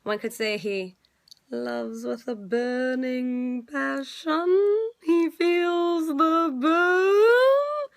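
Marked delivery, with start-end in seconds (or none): 1.5-7.9 very slow, building higher and louder to the end; dramatic drawn-out punchline. Real, not acted. Vlogger capping her Bernie rant, slow and building loud. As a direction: stretch every word, landing the pun big.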